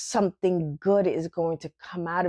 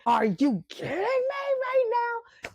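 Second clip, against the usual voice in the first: high, incredulous outburst; are you kidding me, way up high; mock flip out. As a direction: fake freak-out, voice shooting up. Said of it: "just flip out and be like".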